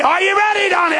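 ringing high call of the Lord. Retold or imitated. Jesus calling the preacher by name, ringing. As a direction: call him to battle. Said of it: "He'll look over to me".

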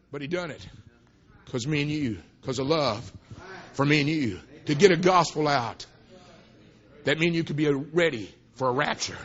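low, soft and measured; tender preaching. Real, not acted. Preacher to congregation on love for me and you, tender. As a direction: drop low, pausing between short phrases. Tender turn after impassioned testimony.

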